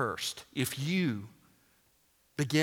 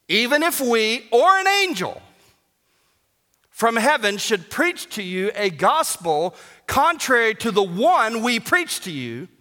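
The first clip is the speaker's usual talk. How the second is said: much higher and louder thundering proclamation; full force preaching; 10 semitones higher, 9 dB louder than usual. Acted. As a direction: thunder the curse from the pulpit. Reads curse passage full force.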